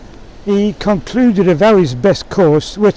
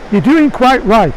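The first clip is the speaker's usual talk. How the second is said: raised, higher call of approval; mild shout; 5 semitones higher than usual. A narrator's reading. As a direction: call it out, short of a real shout. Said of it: "shouted Emma".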